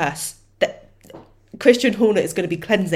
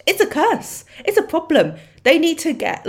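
high, loud exasperated cry; mock outrage; 10 semitones higher, 5 dB louder than usual. Real, not acted. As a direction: exclaim it in playful alarm. Mock outrage about a curse.

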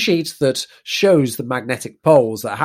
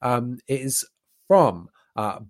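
normal talking voice

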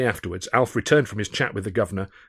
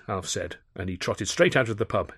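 usual speaking voice

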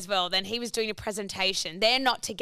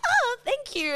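high-pitched, oblivious thank-you; quirky old lady; 14 semitones higher, slower than usual. Retold or imitated. Old seatmate thanking her for the tip, high quirky voice.

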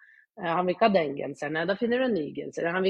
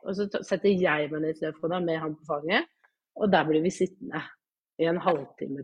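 usual speaking voice

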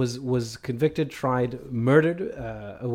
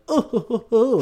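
high, smug mock chortle; smug chuckle; 8 semitones higher than usual. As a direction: chuckle knowingly like stroking a beard. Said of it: "newspapers went like".

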